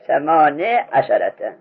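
ثمانیه عشرته slowly, as "samane asharata"; kasra on the nun. Shown as a demonstration. Teacher asking how we say it, nun with kasra. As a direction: drop the ya of ثمانیه, ending it "ne".

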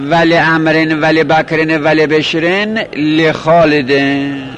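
slowly, "le" opening لامرن, لبکرن, لبشرن, لخالدن; drawn-out list, each لبکرن-type word starting "le"; kasra on following lams. Shown as a demonstration. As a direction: recite لامرن and لخالدن with "le", not "la". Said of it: "کسره داشته باشد".

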